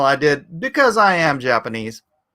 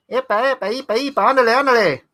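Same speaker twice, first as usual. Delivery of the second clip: higher mock Mexican cartoon accent; a light Mexican cartoon accent, pitched up; Speedy Gonzales; 5 semitones higher, faster than usual. Imitating Speedy Gonzales again.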